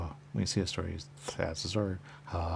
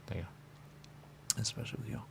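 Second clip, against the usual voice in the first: whisper; 3 semitones higher, 8 dB quieter than usual. Real, not acted. Drawn-out whispered aside.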